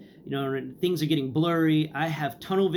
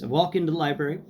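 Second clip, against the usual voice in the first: ordinary talk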